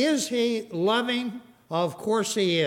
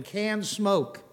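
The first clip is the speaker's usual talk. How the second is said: ordinary talk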